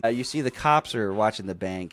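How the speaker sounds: ordinary talk, nothing marked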